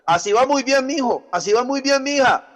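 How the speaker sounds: high, mocking praise; sing-song sarcastic praise to a wayward kid; ironic praise. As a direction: cheer them on sarcastically. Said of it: "No, es una ironía".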